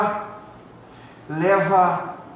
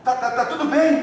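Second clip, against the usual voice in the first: voicing a worried pastor; 9 semitones higher, 4 dB louder, faster than usual.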